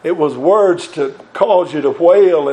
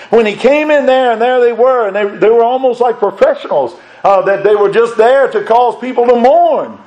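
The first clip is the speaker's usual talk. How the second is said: higher, fervent preaching voice; 5 semitones higher than usual. Acted. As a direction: lift it up and drive it home to the congregation. Preaches with rising fervour.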